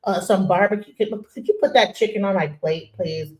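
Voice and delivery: soft, polite office voice; prim, quiet request, lightly put on; formal code-switch. Host showing how nobody talks at the barbecue, mock formal. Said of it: "We don't always talk like this".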